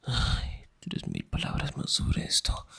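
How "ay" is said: ordinary talk, nothing marked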